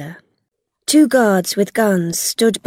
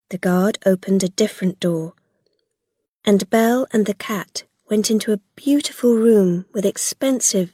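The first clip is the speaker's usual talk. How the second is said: usual speaking voice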